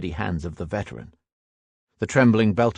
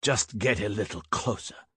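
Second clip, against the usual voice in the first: muttered; 7 dB quieter than usual. A narrator's reading. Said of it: "muttered the veteran".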